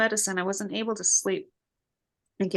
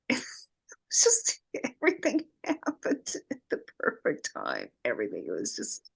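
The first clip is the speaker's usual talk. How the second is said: choked up; 6 semitones lower than usual. Real, not acted. Telling of her mother's visit, tearful and choked. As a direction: voice breaking, half laughing, half crying.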